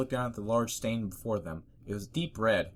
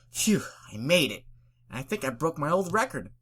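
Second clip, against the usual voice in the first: relieved excited; 6 semitones higher, 5 dB louder than usual. Acted. Dash relieved.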